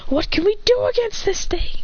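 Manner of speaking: high-pitched desperate questioning. Acted. Hero despairing in acted battle.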